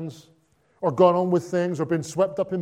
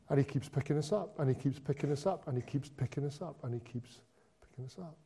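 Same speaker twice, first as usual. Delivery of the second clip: a refrain that fades, each time softer; fading refrain; 3 semitones lower, 11 dB quieter, faster than usual. Acted. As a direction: repeat it softer and softer until almost gone.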